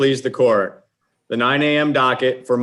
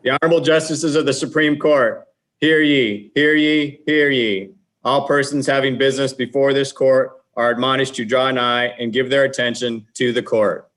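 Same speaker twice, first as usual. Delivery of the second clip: formal court crier call, raised and ringing; grand rich voice; 2 semitones higher, 4 dB louder than usual. Acted. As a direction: proclaim it like a ceremonial crier. Court crier opening the session.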